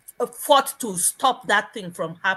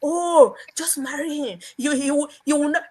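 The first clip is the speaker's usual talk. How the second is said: mock aunt pleading in a raised pitch; aunt pleading high; 7 semitones higher, 4 dB louder than usual. Aunt urges niece to marry the rich man, pitched high. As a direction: play the pushy aunt urging marriage. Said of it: "My aunt was like".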